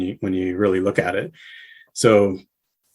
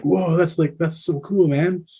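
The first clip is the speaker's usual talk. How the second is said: imitated awe, pitched higher; mock the band admiring the shirt; higher, easygoing mock wow; impressed bandmates; 7 semitones higher than usual. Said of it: "And they're like".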